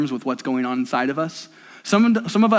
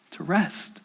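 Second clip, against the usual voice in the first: fading, much softer repeat of to rest; softer echo; 8 dB quieter than usual. Acted. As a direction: let it die away. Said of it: "to say".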